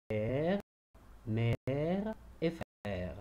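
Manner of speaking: "Père, mère et frère" very slow, open è held long; open è sound. Shown as a demonstration. Teacher making pupils hear the grave accent, very slow. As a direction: stretch each open è slowly. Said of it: "On entend l'accent grave".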